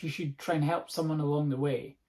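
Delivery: usual speaking voice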